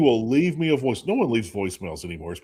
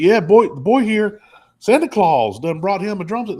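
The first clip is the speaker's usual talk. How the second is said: much higher, louder bragging Southern dad; 9 semitones higher, 8 dB louder than usual. Retold or imitated. Redneck dad voiced in story.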